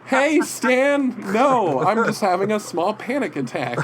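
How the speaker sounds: high, loud, panicky fan; acted flustered reply. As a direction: blurt it to your idol, hammed-up. Acts his own flustered reply.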